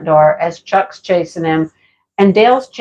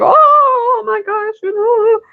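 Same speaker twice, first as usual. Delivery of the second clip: high, hammed-up wail; exaggerated high-pitched lament, fading in volume; mock distraught; 12 semitones higher, slower than usual. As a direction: imitate a distraught child wailing. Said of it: "He was distraught".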